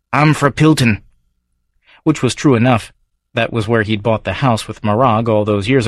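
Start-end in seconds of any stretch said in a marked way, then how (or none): 0.1-1.0 Scots publican's regular, raised and brisk; gruff Edinburgh local sizing up a stranger; Davy's Scots brogue; Scottish barfly. Acted. Davy answering stranger.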